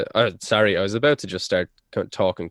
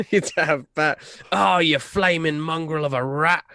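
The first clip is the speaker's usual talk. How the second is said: higher put-on voice, playful scolding; mock son insult; 7 semitones higher than usual. Son insulting his rambling mother, higher put-on voice. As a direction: toss the insults at her like a pantomime son.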